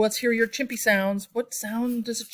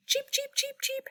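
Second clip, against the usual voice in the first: very high squeaky chirps; bird cheeps; 4 dB quieter than usual. Acted. Showing kids how a lark goes, high chirps.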